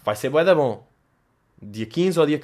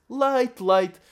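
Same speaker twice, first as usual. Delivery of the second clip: squealed punchline; 8 semitones higher than usual. Retold or imitated. Laughing retelling "leite" punchline.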